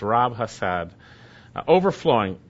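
usual speaking voice

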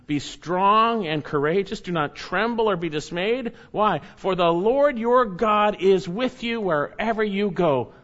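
high, loud, slow proclamation; proclaiming scripture. Acted. Pastor proclaiming God's command to Joshua, high and loud. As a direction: ring out the command.

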